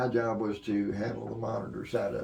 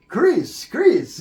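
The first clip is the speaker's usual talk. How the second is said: high, loud call across the stage; 12 dB louder than usual. Retold or imitated. Singer stopping the show, calling his engineer loud and high. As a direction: call the engineer's name sharply, twice.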